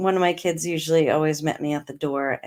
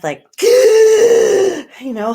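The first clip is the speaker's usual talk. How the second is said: sharp gasp; 12 dB louder, much slower than usual. Retold or imitated. Reliving gasping for breath.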